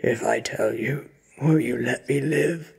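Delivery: loud, frightened bargaining; fearful, strained victim; trembling plea. A narrator's reading. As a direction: beg for your life, rising. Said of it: "He trembles".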